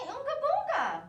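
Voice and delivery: put-on high, loud sing-song baby talk; Daniel's magic words. Brother soothing crying baby sister, high, loud sing-song. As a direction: coo it brightly like a big brother. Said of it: "just say".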